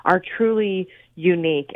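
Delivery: normal talking voice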